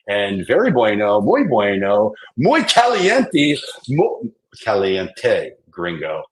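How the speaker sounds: gringo Spanish, hammed up; mock Spanish with an American accent. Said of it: "I talk like a gringo".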